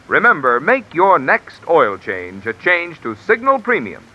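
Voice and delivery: ordinary delivery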